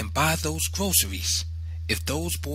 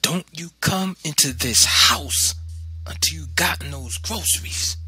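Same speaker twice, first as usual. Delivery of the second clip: high, emphatic forbidding; stern mother; 6 semitones higher than usual. A narrator's reading. Mother locking son out until he shops, stern command.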